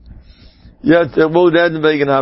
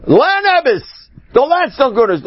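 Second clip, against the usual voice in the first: urgent exhortation; 10 semitones higher than usual. Acted. Preacher urging the idle student to learn, exclaiming. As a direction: burst out high, urging hard.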